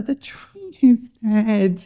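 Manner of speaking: slow, drawn-out moan; groaning. A narrator's reading. Jonah wakes to find his tree dead, groaning. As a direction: stretch the words into a groan. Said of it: "he groaned".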